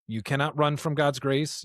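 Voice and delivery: ordinary talk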